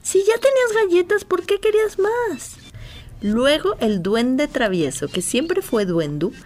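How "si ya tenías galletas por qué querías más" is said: indignant. Acted. Lulú indignant after reveal.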